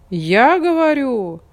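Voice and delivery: rooster voice. A narrator's reading. Rooster speaking.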